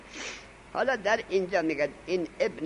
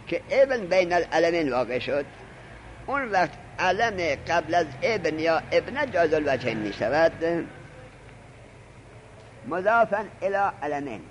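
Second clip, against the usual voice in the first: usual speaking voice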